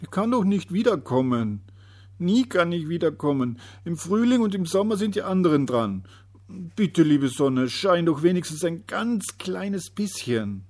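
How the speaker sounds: high, loud desperate begging; desperate pleading. A narrator's reading. Despairing little day begging the sun to shine. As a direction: beg the sun with all you have. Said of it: "Der kleine Tag war ganz verzweifelt".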